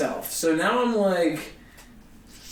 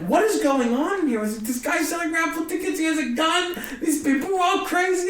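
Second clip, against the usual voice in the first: high-pitched mock panic; retold freak-out; 10 semitones higher than usual. Comic realizing the booker is dangerous, retold freak-out. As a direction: squeal it in rising disbelief. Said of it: "I'm freaking out".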